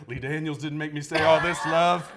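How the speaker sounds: imitating diva shout. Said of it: "She's like".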